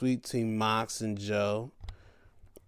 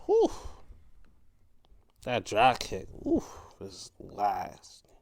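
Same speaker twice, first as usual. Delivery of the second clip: awed whisper; 5 semitones lower, 4 dB quieter than usual. Real, not acted. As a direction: hush it like you can't believe the hit. Awed by a nasty drop kick.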